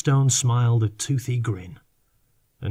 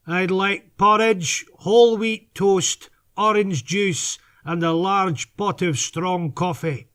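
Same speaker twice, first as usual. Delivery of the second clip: put-on slow, higher Scottish accent ordering breakfast; 8 semitones higher, slower than usual. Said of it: "placed his order in a perfect Scottish accent".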